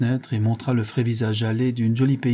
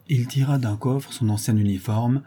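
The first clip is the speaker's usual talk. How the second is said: ordinary delivery, nothing marked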